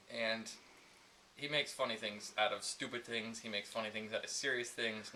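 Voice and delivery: ordinary delivery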